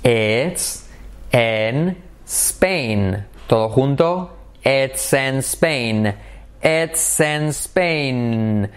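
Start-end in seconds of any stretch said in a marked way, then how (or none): 4.6-8.7 each short word spaced out slowly; very slow drill. Shown as a demonstration. Teacher drilling short vowels very slowly. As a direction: say "It's in Spain" very slowly. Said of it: "cortas y cortas".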